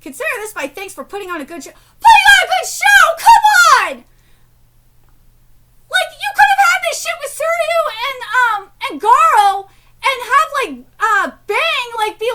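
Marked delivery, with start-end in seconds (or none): 1.7-4.1 outraged yell. Real, not acted. Outraged reaction to manga line. 5.9-10.5 loud, high, fast frustrated yelling; furious fan tirade; angry rant. Real, not acted. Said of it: "'cause I'm really angry right now".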